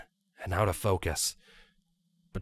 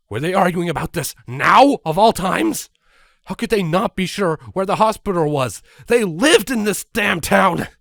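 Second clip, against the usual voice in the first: loud, higher, exasperated rant; 9 semitones higher, 9 dB louder than usual. Acted. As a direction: vent in disbelief. Exasperated disbelief at colts.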